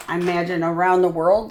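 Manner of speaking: usual speaking voice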